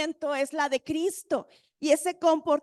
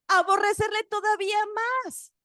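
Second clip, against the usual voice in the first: high, quick burst on the verse; emphatic answer; 7 semitones higher, faster than usual. Real, not acted. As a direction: answer with a high-pitched rush. Answers own question reading the verse. (respuesta enfática, aguda y rápida)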